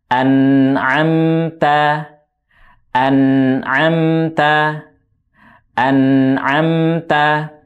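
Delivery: "an'amta" very slow, each fathah a held wide open; over enunciating. Shown as a demonstration. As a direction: draw out "an'amta" with a fully open a. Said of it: "dibuka dengan sempurna".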